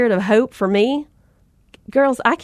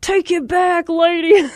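mock demand; 7 semitones higher than usual. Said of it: "wrestle her to the ground and say".

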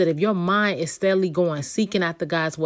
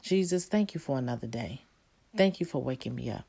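put-on gentle child's prayer; 6 semitones lower, 9 dB quieter than usual. Shown as a demonstration. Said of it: "remind them to say".

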